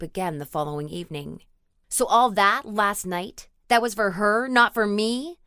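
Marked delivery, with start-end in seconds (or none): 1.9-5.4 loud, slow, higher accusing outcry; tearful jealous shout at her partner; accusing scream. A narrator's reading. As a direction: hurl the blame at her, nearly screaming. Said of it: "she shouted, no, screamed accusingly".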